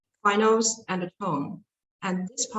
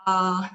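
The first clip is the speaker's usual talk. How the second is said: AH held high and level; high flat tone; 3 dB louder than usual. Shown as a demonstration. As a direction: keep AH on one steady high note. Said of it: "very high tone and high and flat".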